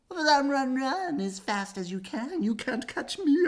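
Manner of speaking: high, loud singsong taunt; taunting cheese man. Acted. Stinky cheese man calling to his chasers, high and taunting. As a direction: tease the chasers gleefully.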